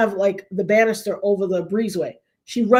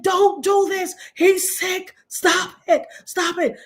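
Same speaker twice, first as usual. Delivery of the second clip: pleading; 8 semitones higher, slower than usual. Retold or imitated. Pleading with the internet again.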